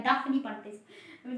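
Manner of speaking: ordinary talk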